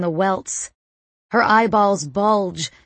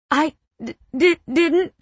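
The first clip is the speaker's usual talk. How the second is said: high, distressed stammering; shocked, stuttering denial; 8 semitones higher than usual. A narrator's reading. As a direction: stumble on the first sounds, pitched up. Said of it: "I stutter".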